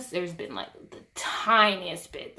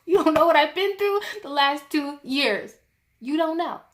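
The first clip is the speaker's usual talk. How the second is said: higher, louder indignant outburst; indignant, raised-voice rant; exasperated retort; 7 semitones higher, 7 dB louder than usual. Acted. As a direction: vent your frustration at the orthodontist. Angry imagined retort to orthodontist.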